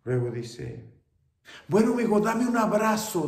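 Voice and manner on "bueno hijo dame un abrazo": high, loud plea for a hug; mother pleading. Acted. Mother begs her son for an embrace, high and loud. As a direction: reach out with a loud, high cry.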